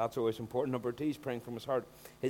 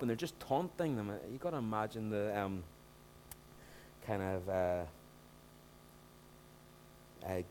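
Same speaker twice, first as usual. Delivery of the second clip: ordinary talk, nothing marked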